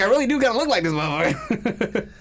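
high-pitched comic realization; high, incredulous retelling; mock disbelief. Acted reaction to the statue.

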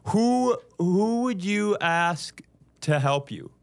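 ordinary talk

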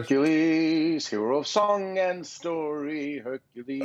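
high, slow, booming cartoon announcer; Newton from Hercules. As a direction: belt it out like a cartoon herald. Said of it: "Newton from the Hercules cartoon".

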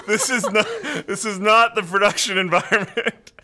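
laughing through the words; amused, giggling disclaimer. Real, not acted. Laughing at placeholder.

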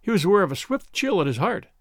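usual speaking voice

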